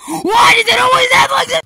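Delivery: high wailing cry, loud. Acted. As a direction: wail it like a crybaby. Wailing at sleeping teammates.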